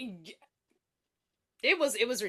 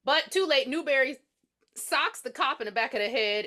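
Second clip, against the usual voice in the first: ordinary talk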